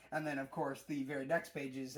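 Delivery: normal talking voice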